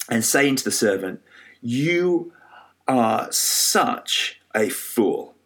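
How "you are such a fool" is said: spaced out word by word, very slowly; slow, deliberate rebuke. Retold or imitated. King to his wicked servant, slow deliberate rebuke. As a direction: let each word land, retold scolding.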